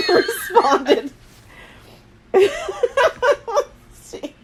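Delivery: high, loud word cracked by laughter; laughing. Real, not acted. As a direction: try to read on through giggles.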